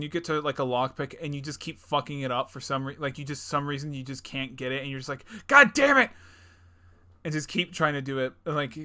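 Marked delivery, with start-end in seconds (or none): 5.5-6.1 high, loud mock-exasperated yell; frustrated outburst. Player failing a lockpick over and over, acted frustrated shout. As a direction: curse loudly at a failed attempt.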